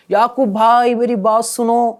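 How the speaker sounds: high, drawn-out shout across the mosque; calling across room. Shown as a demonstration. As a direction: demonstrate the wrong way, hollering from afar. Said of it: "ایسے بولنا ہے".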